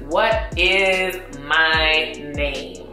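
ordinary delivery

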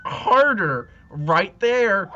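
breathy, loud erotic moaning. Acted. Acted sex scene dialogue.

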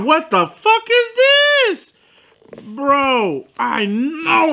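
can't believe what's in the bag; stretched-out indignant squeal; shocked outrage. Real, not acted. Sees half-empty sauce cup.

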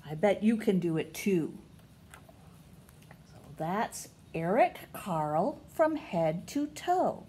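usual speaking voice

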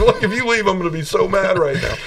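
high-pitched joking warning with a laugh; laughing mock threat. Man warning his friend not to leave, laughing mock-mad.

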